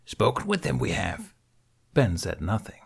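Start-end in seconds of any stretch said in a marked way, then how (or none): 0.1-1.3 odd ancient sage timbre, inverted phrasing; Yoda voice. Acted. Yoda confronting Ben, grave.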